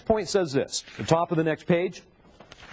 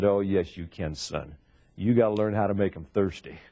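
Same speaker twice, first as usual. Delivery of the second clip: lightly voiced seasoned manager setting a rookie straight; wise old manager; 8 semitones lower than usual. Old manager to cocky young salesperson, low and knowing.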